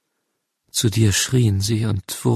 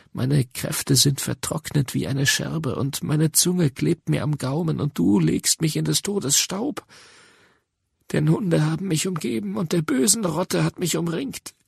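rising anguish; 6 semitones higher than usual. Acted. Sufferer crying to God of being surrounded, rising anguish. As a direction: pitch climbing steadily into anguished lament. Psalm lament building to despair.

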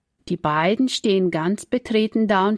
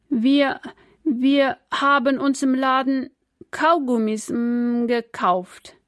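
put-on halting child, higher, stumbling over each word; stammering; 5 semitones higher, 3 dB louder, slower than usual. A narrator's reading. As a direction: stammer it out, caught and embarrassed. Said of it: "kommt er stotternd aus seinem Mund".

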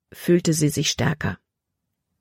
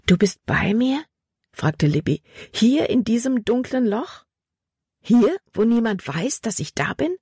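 put-on child's voice; 6 semitones higher than usual. A narrator's reading. Libby questioning God, frightened.

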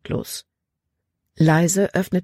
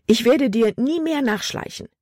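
higher-pitched, emphatic vow to oneself; speaking out loud; 7 semitones higher than usual. A narrator's reading. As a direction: declare it aloud in a raised pitch. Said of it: "dass sie laut sprach". (mit höherer Stimme laut geloben)

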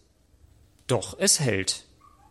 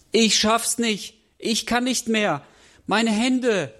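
high, slow, despairing wail; tearful; 7 semitones higher than usual. A narrator's reading. Girl clinging to broken ice, tearful. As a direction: plead high, as if close to tears. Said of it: "weint Nelly".

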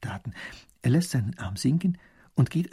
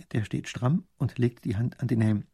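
usual speaking voice

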